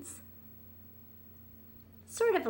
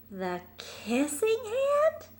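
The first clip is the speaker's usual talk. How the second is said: put-on high, slow wondering child voice; puzzled little raccoon; 12 semitones higher, slower than usual. Chester puzzling over his mother's secret, high and slow. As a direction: ask it like a curious small kid.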